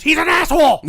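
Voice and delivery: loud, high mock scream; imitated screaming. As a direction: yell it at the top of your lungs, imitating. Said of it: "screaming at the top of your lungs".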